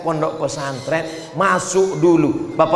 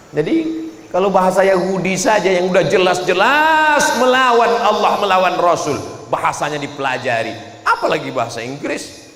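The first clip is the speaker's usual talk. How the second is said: ordinary delivery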